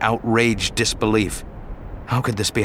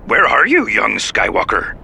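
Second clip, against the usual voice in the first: Yoda voice; 4 dB louder than usual. Acted. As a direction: call out as little Yoda. Yoda over comlink.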